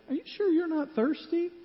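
lightly voiced wife hinting she's thirsty; mock wife hinting heavily; wife asking again. Wife asks again in story.

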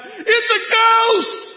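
high, loud, drawn-out terrified scream; screamed ghost cry. Retold or imitated. Disciple in the storm screaming at the figure on the water. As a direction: shriek it like you've seen a ghost. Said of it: "one of them yells out".

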